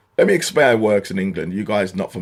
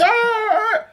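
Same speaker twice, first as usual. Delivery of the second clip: high mock panic cry; panicked yelp. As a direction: squeal like a flustered cashier. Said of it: "He starts panicking".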